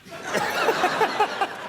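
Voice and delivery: high breathy burst of laughter; cracking up hard; real laugh. Real, not acted. Big laugh after punchline.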